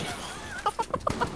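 high, soft laugh; laughter. Real, not acted. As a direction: giggle high and quietly. Laughing at weird friend.

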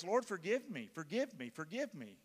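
earnest pleading prayer, fading to a hush; example prayer. Shown as a demonstration. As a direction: model a humble confession for them. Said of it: "Something like this".

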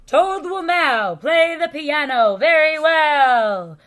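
loud, slow, sing-song stage announcer; strange voice announcing. A narrator's reading. Strange voice announces Toad's piano act, booming. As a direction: stretch it out like a booming ringmaster. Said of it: "said the strange voice".

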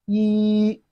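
"yi" held long on one level high pitch; flat tone. Shown as a demonstration. Teacher models Chinese one for learners, flat-tone "yi". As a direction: sustain "yi" without any bend. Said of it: "Flat tone".

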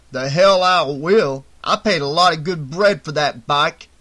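loud, indignant tough guy; angry gang boss. A narrator's reading. Ali to his gang about his wrecked bike, angry refusal. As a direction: refuse angrily and forcefully.

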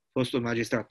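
usual speaking voice

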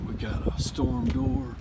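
lightly voiced thief, sneaking; muttering like a burglar; sneaky burglar voice. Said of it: "I'm gonna pretend like I'm a burglar".

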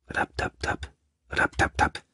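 soft unvoiced tap-tap; whispered tapping noise. A narrator's reading. Narrator imitating a faint tapping sound, whispered.

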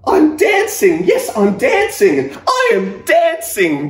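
high, loud, slow shouts of joy; joyful cry. Acted. Gerald finally dancing, crying out high and loud with joy. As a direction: shout it out in pure delight. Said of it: "Gerald cried".

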